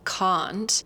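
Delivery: "can't" British-style with a round, deep ah; British pronunciation. Shown as a demonstration. Teacher contrasting accents, British "can't" with round vowel. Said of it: "a round, deep sound".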